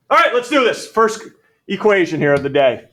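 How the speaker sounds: booming start to the lesson; rally the class loudly; loud call to class. Real, not acted. Math teacher calling the room to order loudly.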